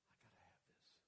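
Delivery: breathless whispered longing; awed whisper. Retold or imitated. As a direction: whisper it as if you can't live without it. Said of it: "they're gonna say".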